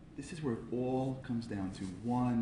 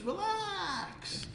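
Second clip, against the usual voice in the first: very high, drawn-out word; comic relax. Retold or imitated. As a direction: sing out a high, stretched plea. Bones tell him to relax.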